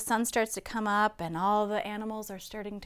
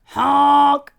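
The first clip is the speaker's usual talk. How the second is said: very loud, long raised honk; yelled truck honk; 5 semitones higher, 16 dB louder than usual. A narrator's reading. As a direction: blare it like a big truck horn. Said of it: "yelled a dump truck".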